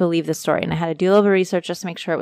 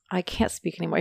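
losing voice; 8 dB quieter, faster than usual. Real, not acted. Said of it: "I'm, like, losing my voice".

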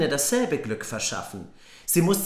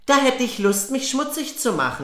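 put-on lazy girl's retort; 9 semitones higher than usual. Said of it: "Die Faule aber antwortete".

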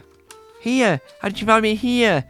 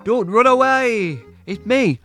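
calling out; 3 semitones higher, 6 dB louder than usual. Acted. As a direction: shout after him to stop. Calling out after a fleeing person.

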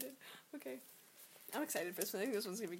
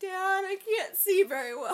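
put-on higher, louder, slow kid complaint; mock kid whine; 9 semitones higher, 7 dB louder than usual. Nephew complaining to dad, playful mock whine.

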